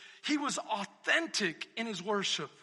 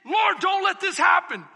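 loud, high plea; shouted plea; 7 semitones higher, 12 dB louder than usual. Retold or imitated. Preacher voicing David pleading with God, shouted. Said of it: "He's saying".

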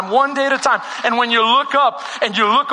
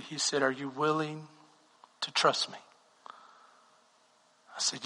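much softer, lower intimate storytelling; hushed testimony; 7 semitones lower, 8 dB quieter than usual. Acted. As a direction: draw them in close with a quiet confession.

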